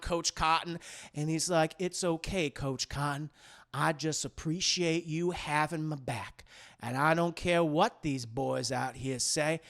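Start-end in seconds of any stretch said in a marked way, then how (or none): 1.8-9.6 calm him down tenderly; gentle reassurance. Retold or imitated. Big Mike soothing coach.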